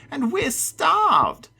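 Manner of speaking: high, loud, drawn-out creature wail; little monster screech. A narrator's reading. Little monster to Matt, starving screech. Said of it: "a little one screeched".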